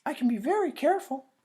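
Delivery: put-on high, loud little-girl voice; indignant little girl; little sister insisting. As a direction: stamp your foot as the small sister. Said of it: "You'll knock it over".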